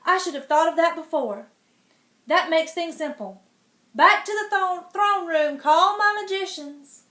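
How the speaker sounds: pleased king calling for magicians; relieved king orders. Acted. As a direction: bark it out, problem solved.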